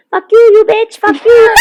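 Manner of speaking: high, loud mock screaming caller; angry caller imitation. Mother's spam caller screaming back at her, hammed-up shriek. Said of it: "the person on the other line was like".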